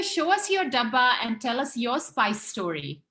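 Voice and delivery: usual speaking voice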